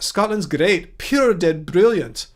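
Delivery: put-on a light Glaswegian Scottish accent, slow; lightly put-on Scottish accent, drawn out. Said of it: "How was that?".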